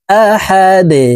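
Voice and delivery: আহাদে with the দ bounced to a falling end; pausal form. Shown as a demonstration. Teacher demonstrating the pause on the final letter, slightly chanted. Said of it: "এইভাবে পড়তে হবে".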